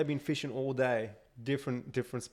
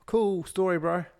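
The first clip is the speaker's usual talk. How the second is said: high, loud fake interest; mock enthusiasm; 7 semitones higher, 7 dB louder than usual. Man humouring a chatty fisherman, mock-impressed and loud. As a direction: brush him off with a hammed-up reply. Acted reply to boring stranger.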